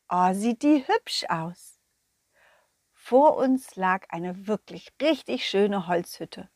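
ordinary delivery

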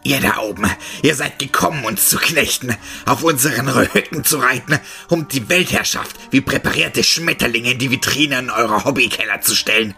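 loud, distorted furious tirade; raging monster roar. A narrator's reading. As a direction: boil over with rage. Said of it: "Vor Wut überkochend ... brüllte".

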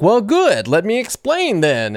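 mimicked eager teacher, starting high and loud; pizzazz and energy. Instructor delighted to explain to a lost student, mimicked pizzazz. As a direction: launch into the explanation with showy gusto. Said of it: "had all this pizzazz and energy".

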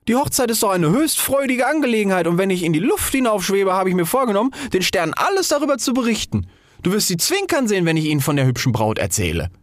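high, bright, happy voice; a small firework delighted by the wedding; cheerful fire ball. Acted. Fire ball tells the rocket about the stars, high and cheerful.

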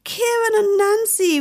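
high, loud burst of delight; a boy calling out two friends' names with joy; excited shout. A narrator's reading. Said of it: "ruft er begeistert".

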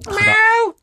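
high, loud miaow like a cat; cat meow. Shown as a demonstration. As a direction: imitate a cat meowing shrilly. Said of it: "geht das so?". (hohes, lautes Katzen-Miau)

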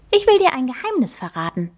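a cute magic mouse introducing herself; little mouse voice. Acted. The magic mouse introduces herself to Emma, high and squeaky. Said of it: "sagt Lieselotte".

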